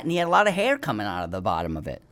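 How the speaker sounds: usual speaking voice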